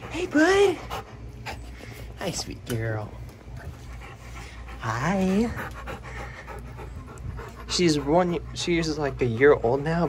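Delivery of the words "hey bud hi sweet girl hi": much higher cooing pet voice; doggy talk. Real, not acted. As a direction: coo at the dog. Greeting the dog.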